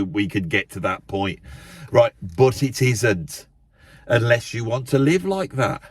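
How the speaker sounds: ordinary talk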